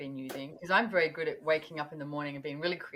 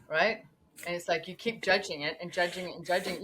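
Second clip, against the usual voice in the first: usual speaking voice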